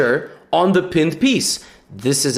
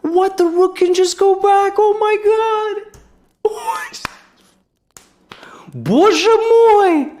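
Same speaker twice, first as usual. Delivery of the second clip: real hysterical laughing screams, pitch sky-high; stunned high-pitched shrieks; shrieking disbelief; slower than usual. Real, not acted. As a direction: squeal in utter shock, half laughing.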